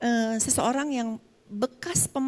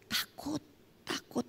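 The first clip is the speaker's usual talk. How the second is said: frightened murmur; 4 semitones higher, 11 dB quieter, slower than usual. Retold or imitated. Abused wife too scared to report.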